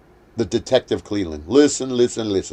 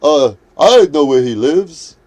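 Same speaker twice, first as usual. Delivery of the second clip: slow, louder dopey mockery; 3 semitones higher, 7 dB louder, slower than usual. Vlogger mimics Tariq slowly and louder, mock-dopey. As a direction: drag it out like a dimwit. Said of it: "Tariq is over here going".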